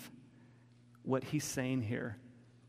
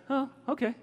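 a much higher, lighter "oh, okay", lightly imitating the clients; mimicked clients; 11 semitones higher, 4 dB louder, faster than usual. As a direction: pop it up high like a cheerful client agreeing. Clients agree, mimicked reply.

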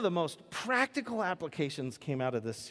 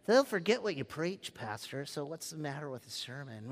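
a put-on side voice, dropping much softer; alternate personality voice; 4 dB quieter than usual. Pastor voicing a sceptical listener in a muttered side voice. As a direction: mutter it like a heckler in the pew. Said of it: "that's my alternate personality voice".

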